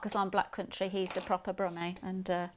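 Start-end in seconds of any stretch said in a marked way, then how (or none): none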